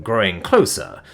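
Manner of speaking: plain reading voice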